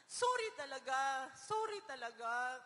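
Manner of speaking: put-on slow, crying sorry to her child; tearful apology. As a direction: weep the apology out slowly. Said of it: "Umiyak na ako".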